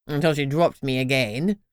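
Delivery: grousing posh crown. A narrator's reading. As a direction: grumble like an offended old crown. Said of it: "Terence groused".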